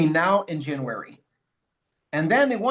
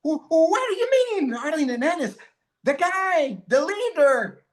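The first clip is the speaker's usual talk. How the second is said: much higher, fast stammering disbelief; shocked Claudia; 11 semitones higher than usual. Retold or imitated. Claudia hearing he went to the mountains instead, shocked and much higher. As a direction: stammer out the shock, pitch jumping up.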